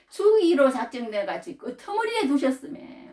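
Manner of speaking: high, slow, loud solemn reading; scripture read out. Acted. Preacher proclaiming Paul's words slowly and loudly. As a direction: lift the verse like a proclamation.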